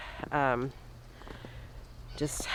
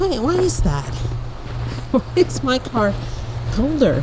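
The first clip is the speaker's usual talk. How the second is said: puzzled; 7 semitones higher, 5 dB louder than usual. Real, not acted. Puzzled by her frosty car.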